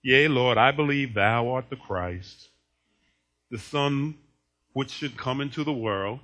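low, slow mock-solemn recital; philosophical. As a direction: recite it low, like a lofty thinker. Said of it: "now she gets philosophical".

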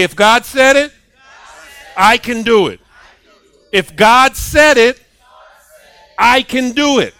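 rally the congregation at full voice; preaching shout. Real, not acted. Preacher leading the congregation in a shouted declaration.

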